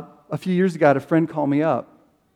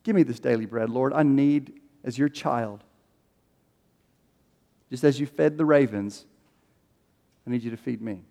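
soft prayer; 5 semitones lower, 8 dB quieter than usual. Shown as a demonstration. Prayer as God's child.

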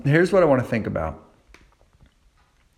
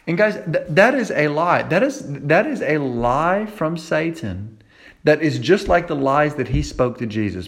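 ordinary talk, nothing marked

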